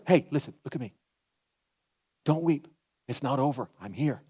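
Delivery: soft, low, gentle reassurance; tender comfort. Retold or imitated. Jesus comforting the grieving widow, soft and tender. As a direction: hush her grief gently.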